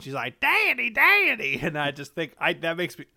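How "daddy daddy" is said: put-on high, loud little girl's cry for her father; little girl calling Daddy. Podcaster imitates young Clarice running out to her father, high and loud. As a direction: call out like a small Southern girl running to daddy. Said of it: "little girl Clarice Starling runs out".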